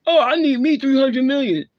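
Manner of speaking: high, loud, put-on whiny voice mocking a rich diva; mocking impression. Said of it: "and Miss Ventura".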